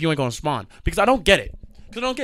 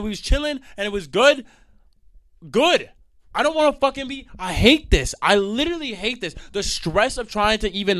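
heated rant; 7 semitones higher than usual. Real, not acted. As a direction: vent passionately. Heated rant about dating.

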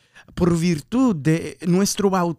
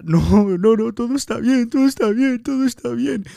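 forced, higher insistence that all is fine; faking being fine; 7 semitones higher, 3 dB louder than usual. Acted. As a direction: push the denial up high, unconvincing. Crying man insists he is fine.

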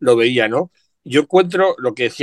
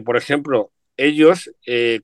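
ordinary talk, nothing marked

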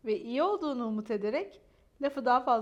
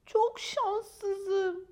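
self-pitying lament; 7 semitones higher, 4 dB louder than usual. Acted. Maya pities herself.

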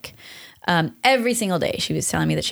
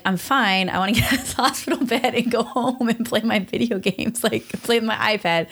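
put-on childlike voice; 6 semitones higher than usual. Kid voice saying she's fine.

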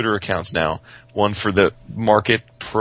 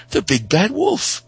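much higher, slow toy announcement; 10 semitones higher, slower than usual. Retold or imitated. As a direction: announce the guess like a gleeful gadget.

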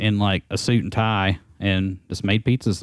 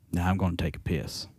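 low, quiet deadpan; dead serious; 4 semitones lower, 9 dB quieter than usual. Retold or imitated. Quitting employee answering the sales guy, dead serious. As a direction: deliver it softly, completely straight-faced. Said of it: "looked at him dead serious".

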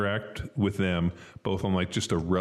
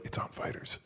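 whisper; 11 dB quieter than usual. Retold or imitated. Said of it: "They're like".

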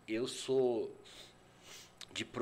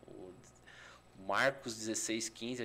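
usual speaking voice

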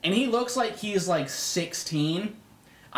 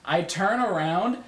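normal talking voice

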